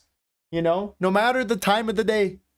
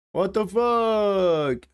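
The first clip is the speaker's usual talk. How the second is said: drawn-out disbelief; much slower than usual. Real, not acted. Confused by the game bug.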